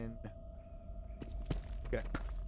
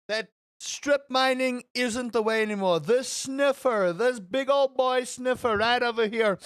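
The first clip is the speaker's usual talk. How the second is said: loud, high bragging, dragging key words slow; boastful hype; 4 semitones higher, 5 dB louder than usual. Real, not acted. Bragging to chat about sniffing diamonds, loud and high. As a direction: strut like the diamond king.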